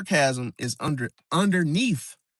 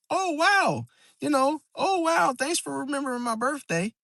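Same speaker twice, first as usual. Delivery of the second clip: high-pitched sarcastic mock gratitude; joking sarcasm; 8 semitones higher than usual. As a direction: turn the hurt into a joke, pitched up high. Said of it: "make it into a joke".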